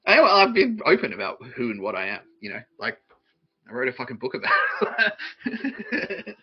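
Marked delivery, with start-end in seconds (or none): 3.7-6.4 laughing through it. Real, not acted. Laughing at own claim.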